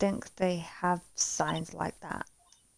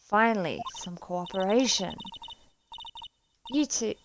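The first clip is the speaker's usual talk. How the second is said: ordinary delivery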